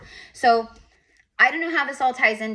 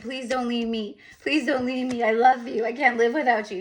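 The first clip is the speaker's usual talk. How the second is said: put-on childlike begging; 4 semitones higher than usual. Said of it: "As a kid, I keep getting that".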